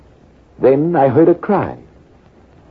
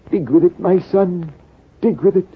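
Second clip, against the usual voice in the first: put-on old Persian urging; 6 semitones higher than usual. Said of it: "The old Persian spoke with difficulty".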